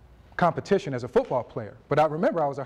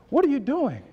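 higher, louder puzzled church lady; lightly voiced older lady caught off guard; phone call from an old church lady, amazed; church lady, surprised; 8 semitones higher, 3 dB louder than usual.

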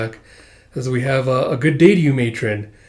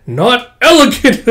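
loud scold; 9 semitones higher, 8 dB louder, slower than usual. Acted. Girl scolds bully as she strikes.

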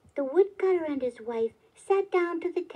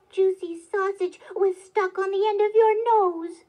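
loud, raised, exasperated reproach; scolding cry; 6 semitones higher, 6 dB louder than usual. A narrator's reading. Wife scolds husband for wasting a wish, loud and cross. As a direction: berate him at a higher pitch, hammering key words. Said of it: "cried the woodcutter's wife".

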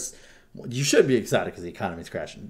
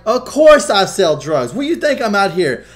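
much higher, louder, scoffing brag; indignant dealer; 11 semitones higher, 14 dB louder than usual. Retold or imitated. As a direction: scoff like it's obvious. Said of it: "He's all like".

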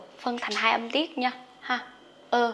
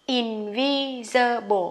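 Invisible drawn out slowly, syllable by syllable; slow model of Invisible; much slower than usual. Shown as a demonstration. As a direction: stretch Invisible for learners. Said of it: "Đọc nhanh nè".

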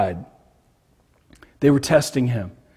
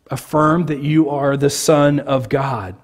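normal talking voice

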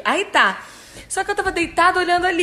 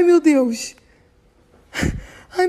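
ordinary talk, nothing marked

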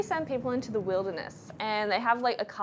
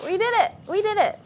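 excited; 7 semitones higher, 6 dB louder, faster than usual. Real, not acted. Celebrating the coconut.

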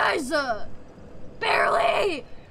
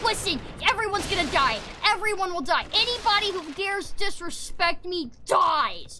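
ordinary delivery

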